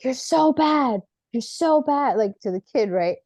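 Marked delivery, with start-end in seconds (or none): 0.0-2.2 high mock scolding of a kid; aunt yelling. Aunt scolding her child, imitating her sharp yell. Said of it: "she was like".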